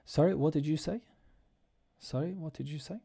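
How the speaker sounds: "Sorry" pitched high, "say" rising in a polite question; friendly questioning lilt from "Sorry" up to "say"; nice polite intonation. Shown as a demonstration. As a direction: lift "Sorry" and end on a rising "say". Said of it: "just say it with nice intonation".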